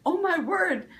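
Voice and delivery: high, loud alarmed exclamation that comes out snappy; startled outcry. Retold or imitated. Retelling to viewers her frightened cry at her husband, high, loud startled shout. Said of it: "But, but the tone of voice was off".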